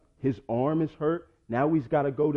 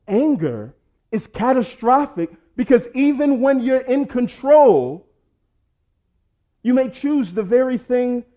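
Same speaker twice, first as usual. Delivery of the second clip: high, loud preaching climax; preaching at full force; 9 semitones higher, 8 dB louder than usual. Acted. Pastor warns the congregation about anger, loud and high. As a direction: shout the warning to the church.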